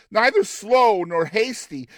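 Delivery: usual speaking voice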